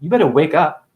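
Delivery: ordinary talk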